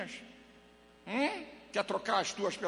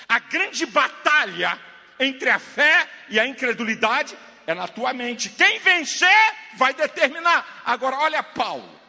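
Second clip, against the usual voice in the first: full-force preaching; 4 semitones higher, 11 dB louder than usual. Real, not acted. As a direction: hammer out each phrase from the pulpit.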